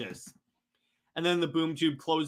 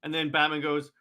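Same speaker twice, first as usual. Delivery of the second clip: ordinary delivery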